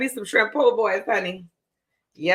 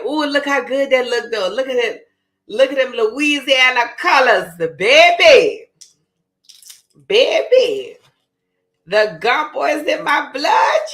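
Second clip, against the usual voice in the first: excited admiring; 5 semitones higher, 5 dB louder than usual. Real, not acted. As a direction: gush over how good it looks. Admiring her gumbo pot.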